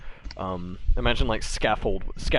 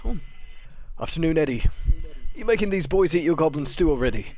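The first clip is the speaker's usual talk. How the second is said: lightly voiced cheerful half-elf, raised pitch; half-elf greeting; 8 semitones higher, 4 dB louder than usual. Half-elf teasing the woman washing bowls, cheerful raised voice. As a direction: tease the woman like an old friend. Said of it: "sees her washing boys and goes".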